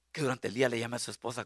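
normal talking voice

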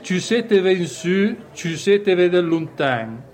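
saying in Valtellinese dialect; Valtellina dialect. Shown as a demonstration. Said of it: "in valtellinese di una frase famosa". (in dialetto valtellinese)

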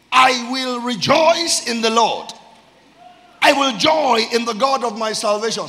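loud, high declaration with stretched words; full-force preaching. Real, not acted. Preacher rousing church to rejoice in the Lord, full-force preaching. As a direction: proclaim it to rouse the whole congregation.

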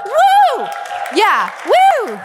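high, loud cheer; excited whoop. Real, not acted. Celebrating her fiftieth birthday with the crowd, excited whoop.

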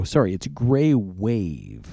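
normal talking voice

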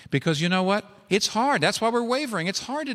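preaching. Acted. Rising pulpit emphasis.

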